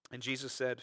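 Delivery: ordinary delivery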